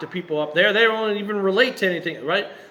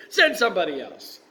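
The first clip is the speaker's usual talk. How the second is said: mock childish defiance, pitched up; foot-stomping tantrum; 5 semitones higher, 4 dB louder than usual. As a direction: act out a stubborn refusal, stomping mad. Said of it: "stomping his feet and saying".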